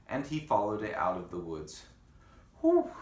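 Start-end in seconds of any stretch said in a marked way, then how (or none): 2.6-2.9 high, loud sigh of relief; Pip's relief at reaching sunlight; whew of relief. Acted. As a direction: blow out a big whew. Relief leaving dark woods.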